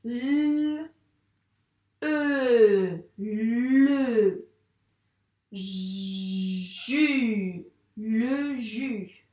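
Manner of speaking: letters then the syllable "le", "ju"; sounding out words. Shown as a demonstration. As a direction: build "le jus" sound by sound. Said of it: "On a le".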